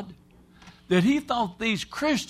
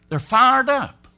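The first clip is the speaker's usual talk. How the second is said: preaching emphasis; fired-up shout; 6 semitones higher, 8 dB louder, slower than usual. Real, not acted. Preacher builds on zeal.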